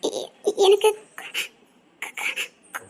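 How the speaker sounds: pained stammer. Acted. Injured, struggling to speak.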